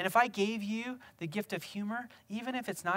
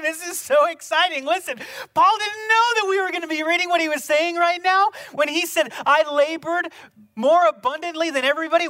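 much higher and louder, thrilled; excited preaching; 8 semitones higher, 12 dB louder than usual. Real, not acted. Pastor thrilled that Paul's words reach us, excited and loud. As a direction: burst out with real excitement.

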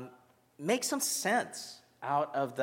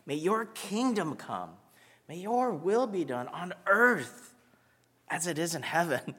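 prayer recitation; 3 semitones higher, 3 dB quieter than usual. Acted.

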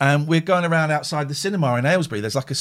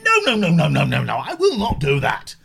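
mock refusal; 2 semitones higher than usual. Refusing a sweary artwork.

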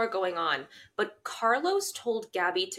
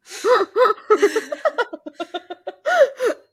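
loud laughter; 13 semitones higher, 9 dB louder than usual. Real, not acted. Bursts out laughing.

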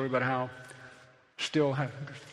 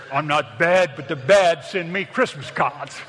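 loud, slow mock tough-guy brag; gangster boast; 8 dB louder, slower than usual. Preacher imitating a con's brag, mock tough voice. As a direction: ham up a prison boast, laughing. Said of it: "that's what these guys would say".

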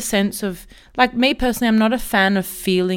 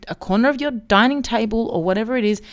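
ordinary delivery

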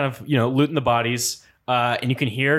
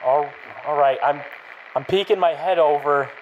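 hesitant guard; 3 semitones higher than usual. Acted. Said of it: "so he goes".

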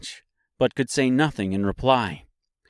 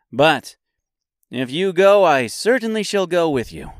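high, loud character voice; high-pitched tin man's promise; Tin Woodman; 6 semitones higher, 5 dB louder than usual. A narrator's reading. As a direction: pledge it high and emphatic.

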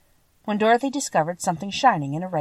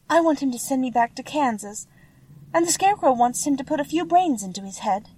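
lightly voiced girl, pitched up; higher voice for an earnest little girl; polite young girl; 6 semitones higher than usual. A narrator's reading. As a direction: explain in a light, raised girl's voice. Voices Dorothy.

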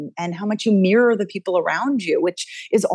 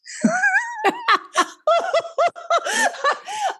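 long, high peal of laughter; real laughing fit. Real, not acted. Coach losing it while explaining human brains, real high laughter. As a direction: burst out laughing helplessly.

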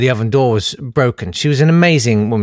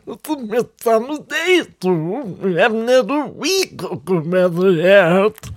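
put-on much higher, slow old lady voice; Auntie Ruby; 7 semitones higher, slower than usual. Great-aunt grumbling about weather to Sue, high and slow. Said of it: "tiny recording of her with her saying".

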